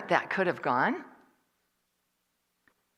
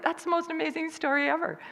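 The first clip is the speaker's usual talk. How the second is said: mock gushing; 12 semitones higher than usual. Imitating impressed listeners.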